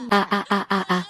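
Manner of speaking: broken, sobbing cries; child sobbing. Acted. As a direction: sob it out like a hurt child. Child cries after being hurt. (sanglots d'enfant blessé)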